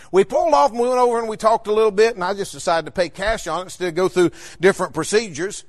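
ordinary talk

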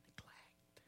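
breathy whispered single word. Real, not acted. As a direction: whisper it for weight. Sermon climax, single word.

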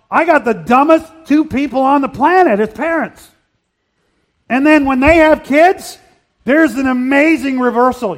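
loud, emphatic sermon delivery; full-force preaching. Acted. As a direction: drive the point home to the dads.

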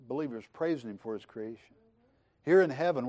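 usual speaking voice

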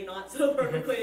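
loud, amused laugh through the phrase. Real, not acted. Participant joking about praying imperfectly, laughing. Amused at own stumbling aside.

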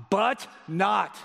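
BUT and NOT each punched separately; emphatic BUT NOT. Shown as a demonstration. Preacher showing the Greek contrast, emphatic BUT and NOT. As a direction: hit BUT, pause, then hit NOT. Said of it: "and then an emphatic not".

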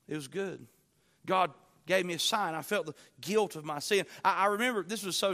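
ordinary talk, nothing marked

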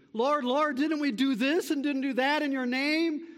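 high, loud desperate protest; false disciples pleading before the judge; pleading professors. Retold or imitated. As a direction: beg to be recognized. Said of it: "Jesus will say to those professors".

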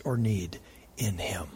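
breathed, intimate whisper; soft whispered plea. Acted. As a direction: lean in and whisper the promise. Intimate appeal at sermon's end.

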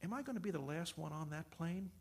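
softly asking the Lord; a hushed, hesitant question in prayer; quiet prayer. Retold or imitated. Retells his second prayer to God.

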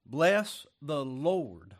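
very slow, loud, drawn-out "Bless the Lord"; preaching at full force. Real, not acted. Preacher answering his own question, slow and loud. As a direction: stretch every word for the congregation.

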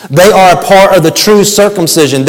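ordinary talk

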